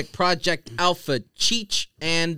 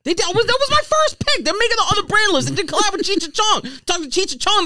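indignant protest; 3 dB louder than usual. Real, not acted. Indignant defense of his pick.

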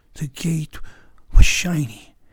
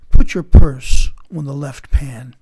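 loud, slow, weighty command; commanding order; 11 dB louder, slower than usual. Acted. Alexander ordering a general to test the scale, commanding. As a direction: give the order forcefully, spacing it out.

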